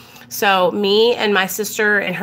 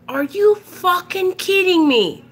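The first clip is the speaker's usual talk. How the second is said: pissed-off dad; 10 semitones higher than usual. Retold or imitated. Dad repeating himself to clueless daughter, incredulous and pissed off. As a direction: repeat it as an exasperated dad, stretching the words. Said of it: "He was pissed".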